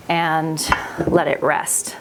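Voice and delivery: ordinary delivery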